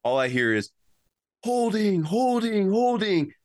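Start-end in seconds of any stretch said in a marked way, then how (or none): 1.4-3.3 high, repeated calls of holding, like a crowd yelling from the sideline; mock sideline yelling. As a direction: call out Holding, Holding, Holding up high and falling each time, like fans yelling at the ref. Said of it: "'Cause they were screaming".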